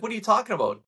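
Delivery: high, disbelieving "what are you talking about"; incredulous retort. Retold or imitated. To the listener, a high incredulous retort. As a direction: scoff back in disbelief. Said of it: "And I'm like".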